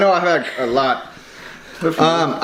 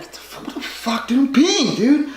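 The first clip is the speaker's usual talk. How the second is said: put-on high indignant drunk voice; drunk self whine; 9 semitones higher than usual. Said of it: "I was like".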